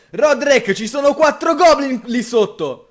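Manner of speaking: high shouted warning call; shouting. Acted. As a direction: yell across the room. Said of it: "io grido".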